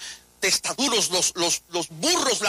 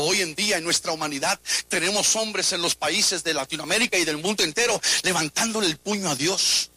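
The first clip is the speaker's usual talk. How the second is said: ordinary talk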